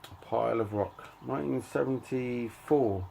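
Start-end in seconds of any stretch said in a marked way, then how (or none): none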